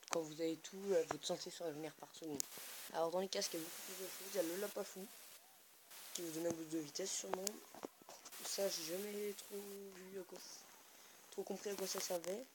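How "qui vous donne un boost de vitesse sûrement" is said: ordinary delivery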